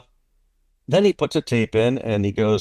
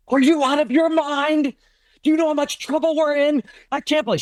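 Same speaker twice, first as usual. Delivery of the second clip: imitating a furious, panicked wife, pitched high; shrill mock panic at him, much higher; high-pitched retold outrage. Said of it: "Oh, she's furious".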